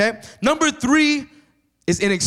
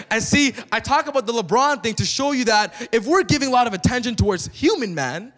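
ordinary talk, nothing marked

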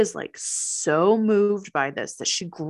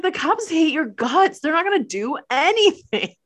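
higher and louder taunt; 7 semitones higher, 4 dB louder than usual. Retold or imitated. Sophie taunting that police won't help. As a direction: rub it in his face. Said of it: "She's like".

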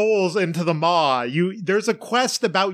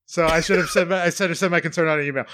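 ordinary delivery

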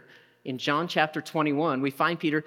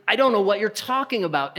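a mild mock rage, higher; angry outburst; 5 semitones higher, 4 dB louder than usual. Peter bursting out at the bystanders, mildly angry. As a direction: snap it out like a cornered man. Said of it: "cursing and screaming".

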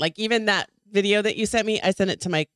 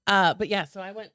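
usual speaking voice

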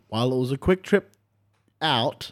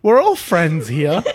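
laughing; 7 semitones higher, 9 dB louder, slower than usual. Real, not acted. GM jokes amid group laughter.

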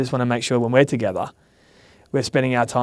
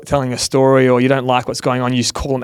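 ordinary talk, nothing marked

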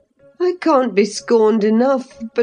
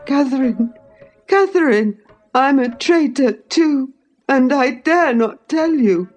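put-on high-pitched, desperate pleading boy; acted sobbing; 9 semitones higher than usual. A narrator's reading. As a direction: beg tearfully for your life, pitched high. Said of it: "he sobbed".

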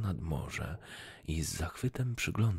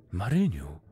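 louder, happy call of a name; cheerful call; 6 dB louder than usual. A narrator's reading. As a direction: call out to her gladly. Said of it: "zawołał rybak wesoło".

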